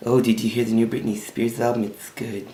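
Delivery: soft, dim-witted airhead voice; hushed impression of a dumb club girl; Tanya. Airhead chatting to him in his car, softly imitated. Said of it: "she talked like this".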